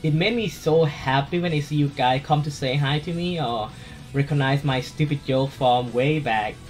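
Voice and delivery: ordinary talk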